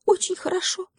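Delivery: high, happy mouse voice; squeaky-high delighted mouse; joyful little mouse. A narrator's reading. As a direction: agree gladly in a tiny high voice. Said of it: "радостно согласился с ним мышонок".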